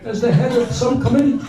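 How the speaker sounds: mock a cautious politician covering himself; imitating Bernie Sanders. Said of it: "was saying".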